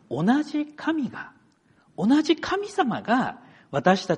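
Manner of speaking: high, insistent sermon delivery; impassioned preaching. Real, not acted. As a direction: drive the message home, pitched high with pauses between phrases. Preaches the main point.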